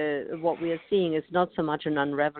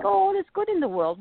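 much higher, quick airy quote; blithe complacency; faster than usual. Acted. Admitting own complacency about the world, blithe and high-pitched. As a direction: voice it high and fast, mock-carefree.

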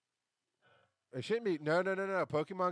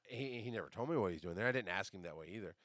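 ordinary delivery

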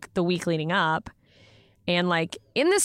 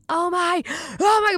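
starstruck gasp; 10 semitones higher than usual. Retold or imitated. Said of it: "you're playing it cool".

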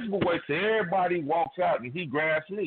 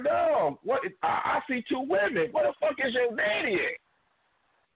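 retold shout; 11 semitones higher than usual. Daughter demanding to know where the other girl's daddy is, high mock shout.

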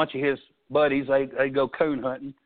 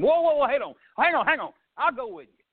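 higher and louder, eager "whoa, whoa, hang on"; excited retelling; 10 semitones higher, 4 dB louder than usual. Retold or imitated. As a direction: jump in before the boy walks off. Father suddenly agrees to go.

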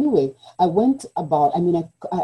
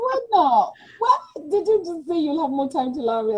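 laughing; 9 semitones higher than usual. Retold or imitated. Retelling her stunned reply, laughing.

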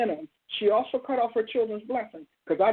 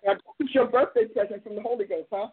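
ordinary talk, nothing marked

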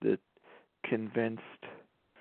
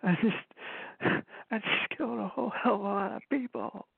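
high-pitched, unhinged movie confession, imitated; crying-and-laughing confession; 10 semitones higher than usual. Quoting a favorite American Psycho line, between crying and laughing. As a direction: imitate a killer teetering between sobs and laughter. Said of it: "between crying and laughing, and then he just laughs and goes".

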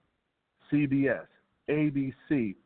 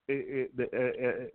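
ordinary talk, nothing marked

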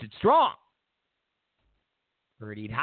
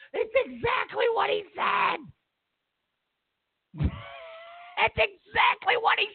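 gleeful shouting; 12 semitones higher than usual. Real, not acted. Host gloating that Napolitano was right, shouting gleefully.

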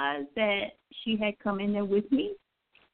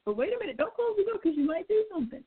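curious cat voice; 6 semitones higher, 4 dB quieter, faster than usual. Retold or imitated. Voicing the cat's thoughts.

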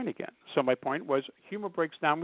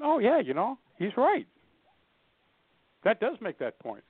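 impressed listener; 7 semitones higher than usual. Retold or imitated. Agreeing audience member voiced.

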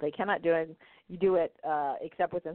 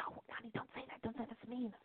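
put-on much softer, higher hush to a child; hushed scolding; 6 semitones higher, 14 dB quieter than usual. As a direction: gently shush the kid. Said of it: "the adults are shocked".

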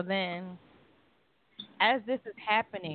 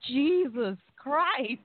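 startled cry; 7 semitones higher, 4 dB louder, much slower than usual. Retold or imitated. Stabbed with epinephrine pen.

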